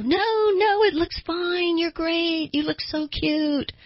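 very high-pitched chorus of reassurance; gushing girlfriends. Acted. Girlfriends reassuring a friend about her hair, high-pitched gushing. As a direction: gush it way up high, stretching the last words. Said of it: "your girlfriends all go".

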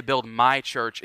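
"my" slowed and weighted in "Build my church"; emphatic my. Shown as a demonstration. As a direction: lean hard on "my", slowly. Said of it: "it is only used emphatically".

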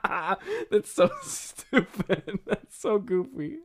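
words pushed through giggles; can barely get it out for laughing. Real, not acted. Laughing at a silly line.

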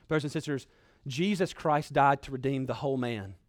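usual speaking voice

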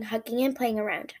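ordinary delivery

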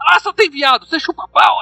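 high mocking yell; mock sergeant yell. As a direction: ham up the sergeant's insult. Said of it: "Ele fala".

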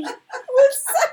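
high, laughing words; giggling out the words. Real, not acted. Laughing with friends about the joke, high and giggly.

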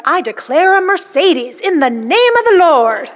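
hammed-up name-it-and-claim-it; mock declaration. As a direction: declare it slowly like an over-the-top faith preacher. Mocks grand prosperity declarations.

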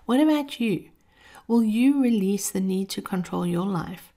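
usual speaking voice

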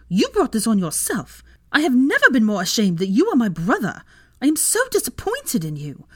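high, loud sisterly dressing-down; outraged scolding. A narrator's reading. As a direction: tear into your brother, ashamed of him. Sister publicly scolds her brother.

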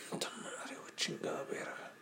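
hissed furious swearing under the breath; angry whispered cursing. Retold or imitated. Tattooed guy on the combi cursing under his breath, angry whisper. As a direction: seethe the curses in a whisper. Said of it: "así bien emputado".

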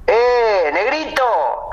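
high, friendly-sized hail from a little nearer; calling out. Acted. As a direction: call to get someone to turn round. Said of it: "Después empezás a acercarte".

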